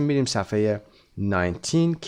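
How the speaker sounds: ordinary talk, nothing marked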